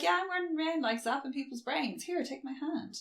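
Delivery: high, soft mock-breezy voice; mocking imitation. Kate offers her hand amid carnage, mocking high voice.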